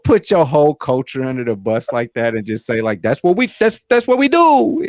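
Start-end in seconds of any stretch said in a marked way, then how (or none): 3.0-4.8 put-on quoting the Italian accent, pitched higher; a light echo of the mock Italian accent; half-hearted Italian voice. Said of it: "bad Italian accents".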